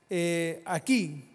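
loud, high shouted question; fiery pulpit shout; preaching shout. Real, not acted. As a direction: cry out to the congregation. Preacher climax.

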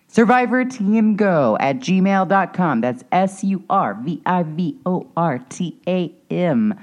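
deliberate letter-by-letter announcement; slow spelling-out. Real, not acted. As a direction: spell it slowly for listeners. Reading out email address.

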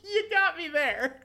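high, slow laughing line. Real, not acted. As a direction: laugh your way through it. Laughs while conceding.

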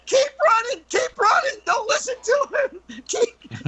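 very high mock-terrified cries; panicked kids. Kids urging each other to run, mock panic. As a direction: squeal urgently like fleeing kids, breaking into laughter. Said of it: "they were sure".

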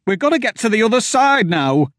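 high, loud, stammering panic; terrified high pleading. A narrator's reading. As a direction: beg them to flee. Wilmer panicking at door.

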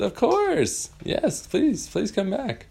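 very high sweet fairy voice; Tinkerbell delighted. Acted. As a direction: welcome them back warmly. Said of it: "She said".

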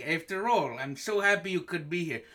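ordinary delivery

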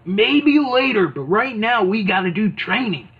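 mock wrestler pep talk; Hulk Hogan. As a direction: put him off like a drill coach. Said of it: "he's like".

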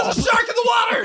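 high, fast, loud alarm yell; excited shout. Acted. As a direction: yell it out like a lifeguard. Playful mock alarm call.

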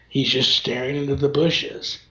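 usual speaking voice

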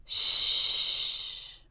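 hushed shh; shushing. Acted. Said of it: "whispers Turtle".

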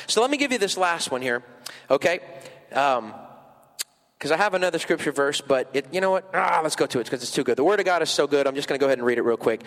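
normal talking voice

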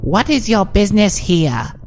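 high, put-on guard's voice; high-pitched creature. A narrator's reading. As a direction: challenge strangers in a high creature voice. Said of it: "unfamiliar, high-pitched voice".

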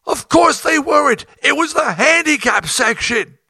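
a high, loud shout; angry tour manager. Retold or imitated. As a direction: yell it back at him in disbelief. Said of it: "He said".

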